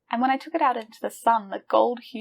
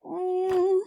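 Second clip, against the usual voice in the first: ordinary talk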